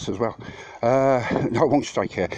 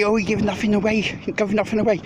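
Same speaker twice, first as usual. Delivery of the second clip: much higher, sing-song mocking whine; mocking Steve; 11 semitones higher, 3 dB louder than usual. Vlogger mimics rival streamer's claim to his chat, high sneering mimicry. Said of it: "and you say".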